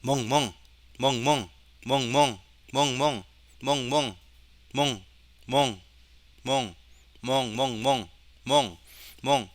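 repeated short spoken woofs, evenly pitched; dog bark imitation. Shown as a demonstration. Teacher testing the trained dog detection, imitated barking. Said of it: "강아지".

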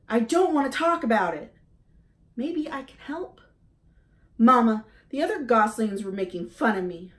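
a hurt little bird who was teased; upset gosling. A narrator's reading. Gosling Bright Bill voiced.

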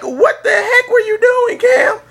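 squeaky high, loud shout at the player; hammed-up high-pitched rant at Cam; mock yell at quarterback. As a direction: scream at the TV in falsetto. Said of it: "I'm like".

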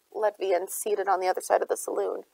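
ordinary delivery, nothing marked

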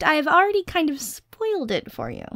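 ordinary talk

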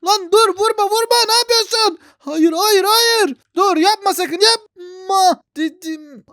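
high, loud panicked begging; frantic pleading. Real, not acted. Gamer begging the attacker to stop, high panicked pleading. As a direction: beg them to stop hitting. Pleading as his character is hit.

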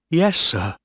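put-on high, timid boy's reply; afraid young officer. A narrator's reading.

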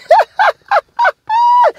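high-pitched staccato laugh; high cackle. Real, not acted. Vlogger joking about greeting her, high cackle. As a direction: burst into high, rapid laughter.

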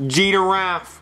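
"Giraffe" as low "jee-duh-raff"; childish three-syllable "jee-da-raff" for "Giraffe"; mispronounced giraffe. Acted. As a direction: add a D to "Giraffe". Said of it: "There's no D".

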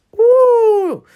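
high, loud whoop of support; cheering whoop. Acted. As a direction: cheer her on with a big whoop. Cheering a nervous friend on.

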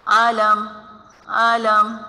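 short quick आलम; quick reading. Shown as a demonstration. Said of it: "जल्दी से पढ़ेंगे इसे हम".

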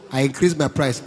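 usual speaking voice

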